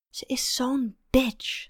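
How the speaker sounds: slow, whispered venting. Acted. Woman texting her sister about the girl, venting whisper. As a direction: whisper it slowly, with spite.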